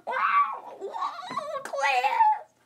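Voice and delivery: very high, loud drawn-out yelp; startled cry. Acted. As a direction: wail out to the class in alarm. Startled teacher cries out to class.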